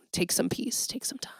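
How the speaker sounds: soft, breathy whisper of a goodbye. Real, not acted. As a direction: hush it like a gentle secret. Soft sign-off to listeners.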